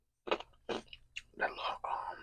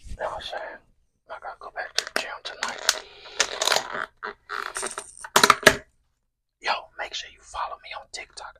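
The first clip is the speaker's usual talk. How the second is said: whispering; 6 dB louder than usual. Real, not acted. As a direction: whisper close to the mic while eating. ASMR mukbang whispered throughout.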